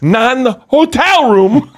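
high, drawn-out incredulous answer; exasperated retort. Retold or imitated. Comic retelling of a friend answering his fiance, exasperated. Retold exasperated reply to girlfriend.

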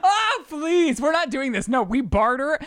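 high-pitched exasperated objection, a laugh in it; playful protest. Real, not acted. Wife waves off husband's complaint about love, high playful protest. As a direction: cut in with a high, scoffing protest.

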